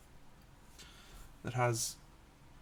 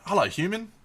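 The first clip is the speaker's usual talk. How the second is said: much higher, louder gruff figure greeting; Red Guardian voice; 9 semitones higher, 9 dB louder than usual. Pretend. Voicing the Red Guardian miniature, booming. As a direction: boom out a comic-book greeting. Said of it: "That's the Red Guardian".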